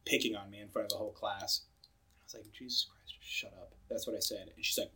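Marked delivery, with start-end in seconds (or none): 2.5-3.7 retold near-whisper grumble, very soft; exasperated aside, almost inaudible; muttered under breath. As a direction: grumble it under your breath, barely voiced. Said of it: "shut up under my breath".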